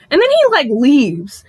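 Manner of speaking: usual speaking voice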